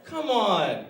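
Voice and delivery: high, slow wheedling; unfunny pleading friend. Retold or imitated. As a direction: mimic a needy joker begging for a laugh. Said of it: "but he comes to me".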